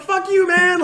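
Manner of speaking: gleeful shouted taunt at the preacher; taunting yell. Retold or imitated. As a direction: holler back to provoke the preacher. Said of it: "I was like".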